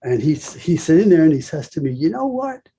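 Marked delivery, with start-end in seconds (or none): 1.9-2.7 high-pitched imitation Georgia accent. Older salon owner to a young visitor, imitated Georgia accent. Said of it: "He got the Georgia accent".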